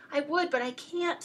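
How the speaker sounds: storybook sobbing moose. A narrator's reading. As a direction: plead with a touch of a sob. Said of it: "sobbed poor Thidwick".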